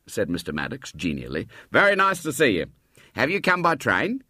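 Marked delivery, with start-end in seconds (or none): 1.7-4.2 higher, friendly greeting; genial old man. Acted. Mr Maddox greeting the boy, genial and higher. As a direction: welcome the boy warmly.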